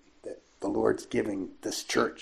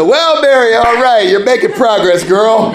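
loud, much higher cheering; delighted praise; 10 semitones higher, 14 dB louder than usual. Retold or imitated. Priest congratulating Mary on her progress, loud delighted cheer. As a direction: cheer her on with a big laugh.

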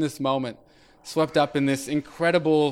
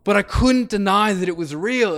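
usual speaking voice

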